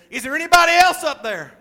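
high, very loud shout; yelling upward. Acted. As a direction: call out past God for another rescuer. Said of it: "he yells up there".

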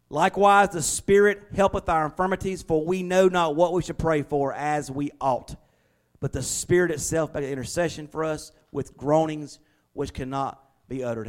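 loud, ringing King James reading; scripture reading. Acted. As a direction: proclaim the verse to the congregation. Pastor reads scripture aloud.

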